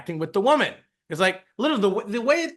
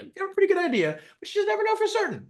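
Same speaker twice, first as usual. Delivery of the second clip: much higher, fast, sing-song mocking echo of own line; high silly voice; much faster than usual. Repeating own point to viewers, in a high mocking voice.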